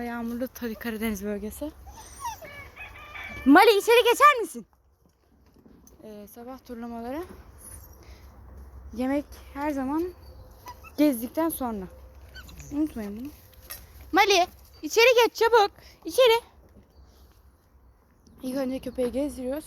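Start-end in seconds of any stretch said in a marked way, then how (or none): 3.4-4.7 high, loud command to get in; loud order. Real, not acted. Ordering dog inside. 14.1-16.4 high, loud hurried command; loud impatient order. Real, not acted. Ordering dog inside.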